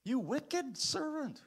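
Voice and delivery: high, slow rebuke; a stern master's cry; scolding master. Acted. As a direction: scold him as the angry master. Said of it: "the master called the servant in".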